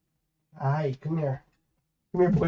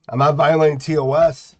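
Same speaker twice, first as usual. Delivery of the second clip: loud protest; 2 semitones higher, 7 dB louder than usual. Real, not acted. Defending himself after ban.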